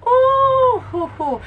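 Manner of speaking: high, loud "ooh" falling into hoots; impressed whoop. Real, not acted. Fan amazed at their dancing skills, impressed whoop. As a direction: whoop high in admiration, then ease off.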